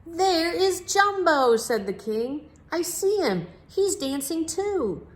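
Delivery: high, loud, slow delight; excited. Acted. The king spotting Jumbo dancing at the party, excited. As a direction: call out with glee at the sight.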